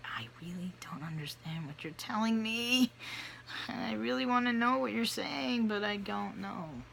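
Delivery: high, plaintive self-quote; helpless plea. Acted. Speaker to someone with a thick accent, helpless high plea.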